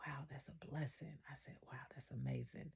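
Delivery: awed hush. Retold or imitated. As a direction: whisper it in wonder. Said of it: "I became overjoyed".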